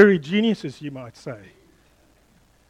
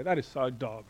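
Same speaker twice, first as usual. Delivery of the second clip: lightly voiced teenager, casual; a hint of a teenager saying it; mild teen slang voice; 5 semitones lower, 10 dB quieter than usual. Shown as a demonstration. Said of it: "Teenagers would say".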